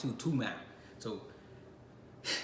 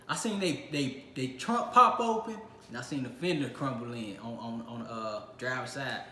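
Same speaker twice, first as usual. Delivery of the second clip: usual speaking voice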